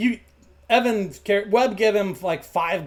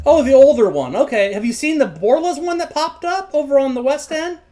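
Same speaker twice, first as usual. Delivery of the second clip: put-on higher, animated boyish questioning; curious recognition; 6 semitones higher, 5 dB louder than usual. Boy asks stallholder about a rival bacon shop, curious. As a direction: perk up with keen, higher-pitched curiosity.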